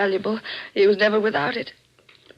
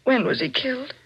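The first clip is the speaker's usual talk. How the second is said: ordinary talk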